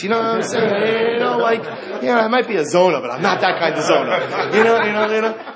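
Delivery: high, loud sing-song with laughter; hammed-up teasing harlot, half singing; mock harlot voice. Rabbi voicing the harlot mocking the fallen sage, hammed-up and high.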